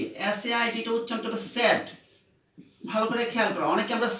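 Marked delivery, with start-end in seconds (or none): 1.4-1.9 স্যাড, rhymes with bad; স্যাড as in sad; said pronounced sad. Shown as a demonstration. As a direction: say স্যাড with a short flat a, not সেইড. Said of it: "এর উচ্চারণটা আমরা এসআইডি".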